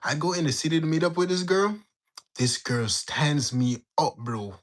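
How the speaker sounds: ordinary talk, nothing marked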